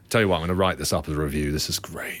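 fast, then low beer-snob review; mock a pub beer critic; pompous beer reviewer. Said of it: "not gonna go".

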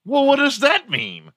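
very high squeaky question; squeaky friend voice. Pretend. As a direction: squeak it out in disbelief. Friend in skit.

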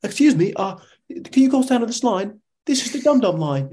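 high-pitched mock-polite request; imitating Nate. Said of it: "he was like".

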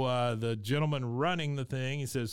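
usual speaking voice

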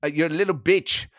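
irritated German manager impression; comic, indignant football boss; Thomas Frank. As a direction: bristle at the interviewer in German-accented English. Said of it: "Thomas Frank, it's been a pleasure".

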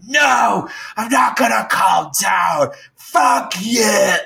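high-pitched mock screaming; retold yelling. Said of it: "this yelling went on".

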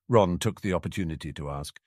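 usual speaking voice

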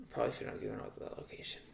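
quick, soft whispered aside; hushed aside. Real, not acted. After naming the meeting spot, a quick hushed whisper. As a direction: murmur it fast like a slip you regret.

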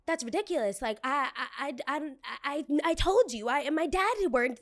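a customer losing her cool, stammering indignantly; flustered and indignant. Retold or imitated. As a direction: stumble over your words, offended. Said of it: "her tone is changing up".

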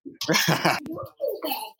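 high, breathy amused laugh; light laugh. Real, not acted. Amused by the question.

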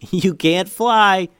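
loud slow laughing yell. A narrator's reading. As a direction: call it out, laughing. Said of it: "yelled Eli".

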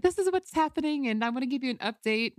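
high, bubbly chime-in; lightly mocking her own chirpy self; eager update. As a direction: gush the news to the girls. Her usual eager brunch update.

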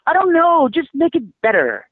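high, loud mock of a lazy note giver; dismissive note-giver. Writer mocking a vague note from a critic, high dismissive mock voice. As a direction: toss it off like a clueless executive.

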